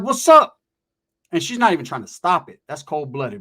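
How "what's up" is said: loud, high "what's up"; a sudden loud challenge; challenging shout. Retold or imitated. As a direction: call him out hard. Confronting Chino outside.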